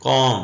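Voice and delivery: कॉम said firmly as kaam, no l; silent l. Shown as a demonstration. Teacher to students, firm कॉम with silent l. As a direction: say कॉम clearly, dropping the l. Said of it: "एल silent है".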